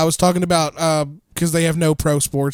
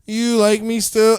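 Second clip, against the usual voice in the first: higher, louder, slow needy plea; drunk friend impression; 6 semitones higher, 4 dB louder, slower than usual. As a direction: drag it out like a drunk begging for reassurance. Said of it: "Rob did Snow Angels and said".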